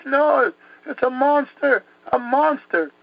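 high-pitched mock terror; retold screaming. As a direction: act out a child crying a warning. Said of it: "I started screaming".

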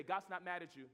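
usual speaking voice

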